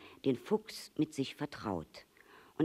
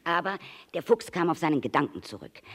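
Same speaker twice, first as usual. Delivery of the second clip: ordinary delivery, nothing marked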